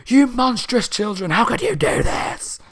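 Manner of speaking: high, loud mock scream of a melting wizard; hammed-up dying scream; retold scream. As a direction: shriek it like the villain is melting away. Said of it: "And he's screaming".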